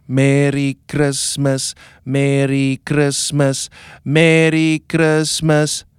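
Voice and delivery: slow rhythmic "Merry Christmas" on the beat. Shown as a demonstration. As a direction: chant each "Merry Christmas" slowly to the beat. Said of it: "one group goes".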